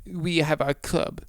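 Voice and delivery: mock the club kids in a hammed-up German accent; smug club-goer in a mock German accent. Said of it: "I don't know why I did Fr".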